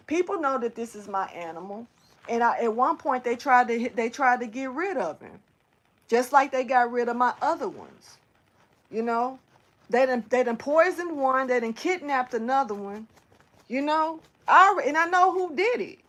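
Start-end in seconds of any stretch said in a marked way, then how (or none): none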